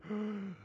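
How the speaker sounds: mock gasp of dread; acted alarmed gasp. Preacher acts Jacob hearing of four hundred men, alarmed gasp. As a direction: suck in breath as if frightened. Jacob hears of Esau coming.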